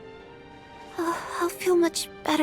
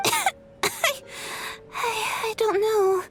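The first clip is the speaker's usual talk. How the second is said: coughing; 7 semitones higher, slower than usual. Acted. Sick girl coughing mid-sentence.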